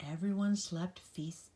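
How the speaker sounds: ordinary delivery, nothing marked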